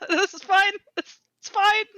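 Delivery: high, loud, strained cheer; dragged off the wheel, nervously insisting she is fine; forced this-is-fine. Acted. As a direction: cover the panic with a bright yelp. Dragged aside, insists it's fine.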